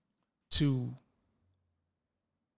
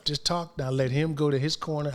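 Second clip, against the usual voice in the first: ordinary talk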